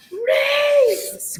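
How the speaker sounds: high, loud, drawn-out name call; screaming. Retold or imitated. Contestant spotting Reese from the balcony, screaming his name.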